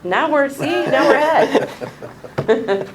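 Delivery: laughing while talking; high, slow, giggly delivery. Real, not acted. Joking about being ahead.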